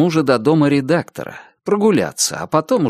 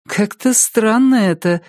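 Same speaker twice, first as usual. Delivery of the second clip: slow, higher, doubting voice; distrustful; 10 semitones higher, slower than usual. A narrator's reading. Ex-wife doubting what the policeman says, distrustful. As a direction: draw it out in mild disbelief. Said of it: "недоверчиво проговорила она".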